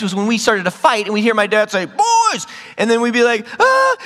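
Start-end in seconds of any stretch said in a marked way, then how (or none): 1.9-2.5 high mock dad yell; dad calling out. Dad calls out to fighting sons. 3.6-4.0 high, loud mock yelp; startled yelp. Boys caught fighting, acting startled.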